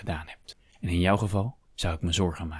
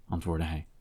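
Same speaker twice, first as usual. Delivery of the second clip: ordinary delivery, nothing marked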